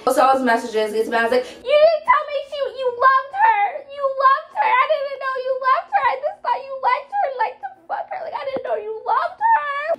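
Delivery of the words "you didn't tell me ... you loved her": very high mock-wailing girlfriend; hammed-up squeaky jealous whine. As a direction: squeal it out in one high, rushed, hurt stream. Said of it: "That's what eel sounds like in my head".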